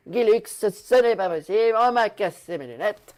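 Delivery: loud lightly voiced Chirac; a hint of Chirac complaining; lightly voiced Chirac impression. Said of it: "ça ressemble plus à Chirac".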